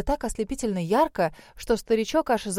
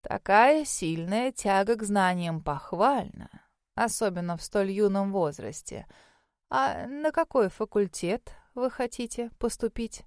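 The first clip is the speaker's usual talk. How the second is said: put-on slow, measured elderly examiner; old mage, approving. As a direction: weigh each word like an old scholar. Voices the old mage questioning applicant. (неторопливый старый маг, одобрительно)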